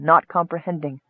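ordinary delivery, nothing marked

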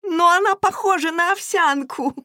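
put-on high, piping child voice; acted small girl, high-pitched protest; little girl's squeak. A narrator's reading. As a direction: squeak it like the youngest child complaining. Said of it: "пропищала младшая девочка".

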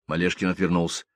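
ordinary delivery, nothing marked

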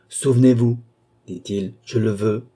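will her to remember; commanding order. A narrator's reading. Balsamo commands the sleeping André, forcefully. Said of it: "un redoublement de volonté et d'action".

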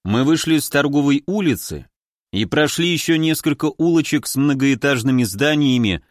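usual speaking voice

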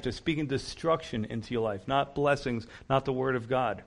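normal talking voice

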